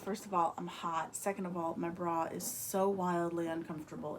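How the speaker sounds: ordinary talk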